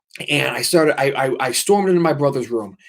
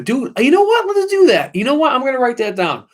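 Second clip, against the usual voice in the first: very high-pitched, louder burst of enthusiasm; real excitement; 12 semitones higher, 4 dB louder than usual. Real, not acted. Streamer seizing on ghost story stream idea, excited squeal. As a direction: squeal it like a brilliant idea just struck.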